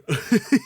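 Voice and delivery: high, loud chuckle; short laugh. Real, not acted. Teacher amused at yawning on camera, laughing. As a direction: chuckle at your own yawn.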